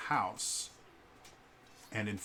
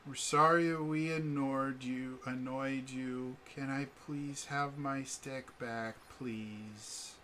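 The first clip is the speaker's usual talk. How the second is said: put-on sheepish younger child; 4 semitones higher, slower than usual. Younger kid asking Vala for his stick back, sheepishly. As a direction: ask meekly, like a child told to apologise. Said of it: "the younger one".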